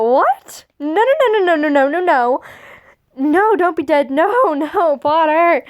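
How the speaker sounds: hammed-up panicked denial, spilling over into laughs; distraught Draco. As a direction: Draco begging him not to be dead.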